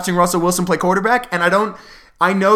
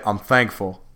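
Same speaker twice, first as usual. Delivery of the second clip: low, flat mumbled thank-you; lightly imitated terse athlete; Marshawn Lynch impression; 10 semitones lower, 4 dB quieter, slower than usual. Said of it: "there's that press conference where he just said".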